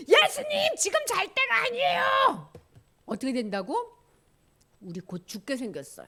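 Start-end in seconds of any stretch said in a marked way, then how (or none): 0.1-2.5 high, exasperated acted outburst; angry disciples. Disciples shaking sleeping Jesus awake, high angry protest. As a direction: scold a sleeper in a high, urgent voice. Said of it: "예수님한테 막 화를 내는 거야".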